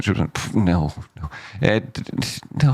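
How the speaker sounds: ordinary talk, nothing marked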